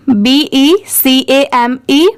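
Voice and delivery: ordinary delivery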